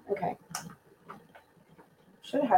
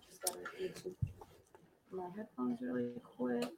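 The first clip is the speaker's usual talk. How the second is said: whispering; 4 semitones higher, 18 dB quieter than usual. Real, not acted. Fetching headphones away from mic.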